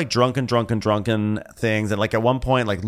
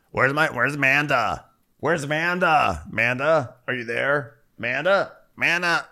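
higher sing-out calls, with gaps; mock searching calls; 5 semitones higher than usual. Fiancé looking all over for his fiancée, calling out. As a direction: call out a name as if wandering the house.

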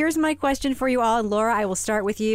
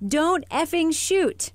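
slow, spaced-out parrot line, a little higher; parrot quote; 6 semitones higher, much slower than usual. Retold or imitated. Host quoting the parrot, slow drawn-out mimic. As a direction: mimic the parrot's words slowly. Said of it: "and then the parrot says".

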